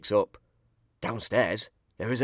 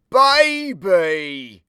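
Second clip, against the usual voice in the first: higher, much louder reveal; astonished; 7 semitones higher, 10 dB louder than usual. A narrator's reading. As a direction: gasp it out in disbelief. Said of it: "Burglar Bill puts the baby".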